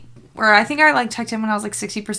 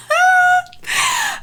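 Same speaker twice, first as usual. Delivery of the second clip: high laugh that tips into a whimper; half laugh, half cry, overwhelmed; 3 dB louder than usual. Real, not acted. Overwhelmed by obsession with book.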